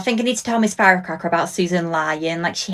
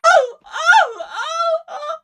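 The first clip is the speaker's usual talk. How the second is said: very high sobbing shouts; hysterical crying; much slower than usual. Acted. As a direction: wail through laughing sobs. Acted Big Brother grief scene.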